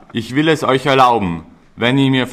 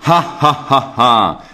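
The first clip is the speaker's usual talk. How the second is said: villain gloating laugh; slower than usual. Acted. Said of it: "brüllte er".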